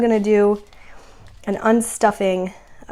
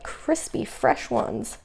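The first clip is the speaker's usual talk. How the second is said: whispering; 9 dB quieter, much slower than usual. Real, not acted. Whispering about crisp new bills.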